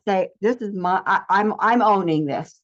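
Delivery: usual speaking voice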